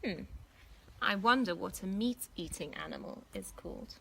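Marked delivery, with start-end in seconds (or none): 2.7-3.9 whispered hint; whispered aside. A narrator's reading. Narrator teasing young listeners with a whispered question. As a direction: lean in and whisper it.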